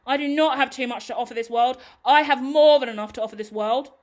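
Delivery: loud, emphatic clapback; sassy, loud rebuttal with NOT stressed; defiant retort. Real, not acted.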